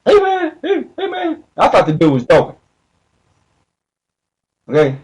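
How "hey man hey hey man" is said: much higher, pleading voice of a stuck man; stuck addict begging. Retold or imitated. Retelling the stuck man in the alley begging for help, high pleading whine. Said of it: "He's sitting there stuck".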